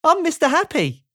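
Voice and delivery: high, chirpy storybook character; bubbly cartoonish introduction; Mister Happy. A narrator's reading. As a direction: introduce yourself in a high, cheery voice.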